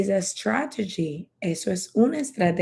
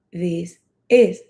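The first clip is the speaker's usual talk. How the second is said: "This is" as two separate words; unhurried, word-by-word "This is"; slow, separate words; 4 dB louder than usual. Shown as a demonstration. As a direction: say "This", pause briefly, then "is". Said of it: "Cuando lo diga rápido".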